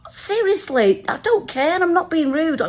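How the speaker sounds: high-pitched retold exasperation; exasperated protest. Passenger fed up with the quiz, exasperated. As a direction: protest as if at your limit.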